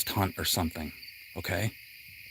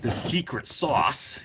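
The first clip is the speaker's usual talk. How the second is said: put-on New York accent; 3 semitones higher, slower than usual. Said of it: "How about a New Yorker accent?".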